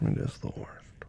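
sympathetic whisper. Acted. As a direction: breathe it softly. Sympathizing with girlfriend's cramps.

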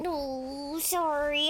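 ordinary talk, nothing marked

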